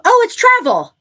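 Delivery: loud, high burst of sudden discovery; excited realization. Real, not acted. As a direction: exclaim it as the answer suddenly hits you. Sudden realization during the card reading.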